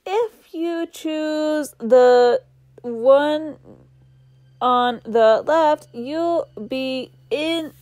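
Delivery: ominous talking book. Acted. Talking book teasing the girl about which door, slow ominous drawl. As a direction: draw every word out like a sly trickster.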